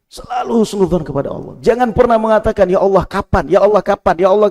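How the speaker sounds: high, loud exhortation; preaching at full force. Acted. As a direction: urge the faithful not to complain.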